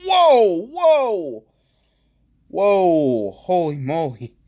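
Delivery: high, loud whoa of amazement; excited shouts. Real, not acted. Pulling a rare foil, shouting in amazement.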